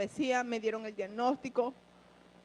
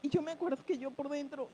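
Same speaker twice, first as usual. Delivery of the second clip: ordinary talk, nothing marked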